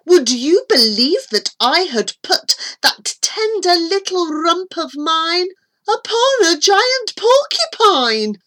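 usual speaking voice

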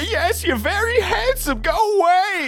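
squeaky falsetto brush-off; flustered cab driver. Acted. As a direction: shoo away a flirt in a very high voice.

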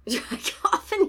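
high, loud laughing echo; laughing question. Real, not acted. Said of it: "Is it a dry coffin?".

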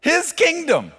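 high, loud preaching burst; preaching at full force. Acted. Preacher proclaiming the kingdom to his church, loud and high. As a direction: proclaim it to the room.

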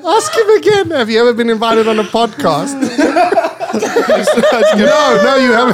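loud, laughing taunt; laughing, teasing and loud; laughing tease. Real, not acted. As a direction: needle him while cracking up.

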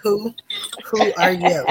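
playful high pretend not to know her, a touch of it; teasing host pretends not to know her guest; mock stranger. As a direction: ask as if meeting her for the first time. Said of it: "I'm gonna act like I don't know her".

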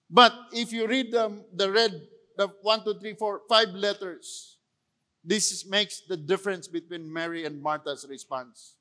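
ordinary talk

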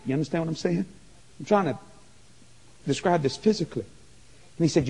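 ordinary talk, nothing marked

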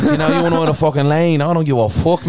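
trash-talk like Conor, cocky; mock Irish fighter accent. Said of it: "That was a good American accent as well".

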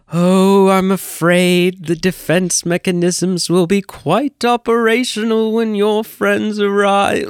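high, super-mocking villain voice; mocking evil-ruler impression; Emperor. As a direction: taunt with fake regret, pitched high. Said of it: "super mocking tone".

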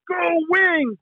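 caller's cheer over the phone; mock yell. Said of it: "they would yell".

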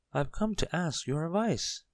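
high, small character voice; tiny Mister Small. A narrator's reading. Tiny man wondering how to reach the bell, high voice. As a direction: pitch up high for the little man.

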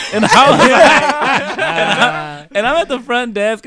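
high, loud laughing through the words; breaking up mid-story; can barely talk for laughing. Real, not acted. Cracking up retelling story.

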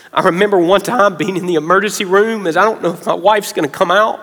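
usual speaking voice

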